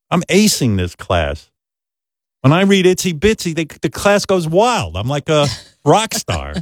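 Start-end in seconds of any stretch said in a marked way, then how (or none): none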